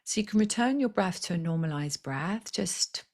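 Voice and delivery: guided meditation. Acted. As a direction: talk them gently into their center. Leads breathing meditation.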